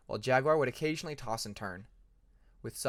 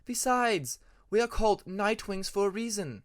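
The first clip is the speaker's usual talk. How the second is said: higher, lightly voiced firm mother; mother lecturing; 6 semitones higher, 4 dB louder than usual. A narrator's reading. Mother telling young Peacemaker why Nightwings sleep by day, firm and matter-of-fact. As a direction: set him straight like a patient mother. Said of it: "she told him more than once".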